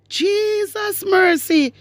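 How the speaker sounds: high, drawn-out cry of disbelief; appalled exclamation. Real, not acted. Host reacting to a lie about Mark Golding, appalled. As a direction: stretch it out slowly, pitched high in shock.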